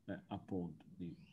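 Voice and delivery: distant, faint voice over a poor line; garbled audio on a bad call; bad connection. Real, not acted. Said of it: "ho una connessione pessima".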